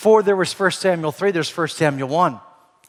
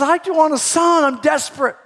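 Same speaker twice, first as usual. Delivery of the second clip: desperate plea; 10 semitones higher, 4 dB louder than usual. Retold or imitated. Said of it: "she says".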